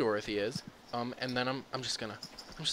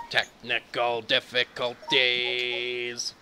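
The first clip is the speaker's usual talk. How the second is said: slow, loud announcer voice; 7 dB louder, much slower than usual. Pretend. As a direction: announce it like a broadcast. Announcer joke.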